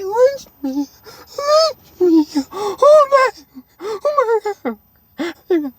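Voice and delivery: high, drawn-out panicked whimper; hammed-up horror, wailing slowly up high; mock-terrified wailing. Kid warned he may be lynched, mock-terrified wail.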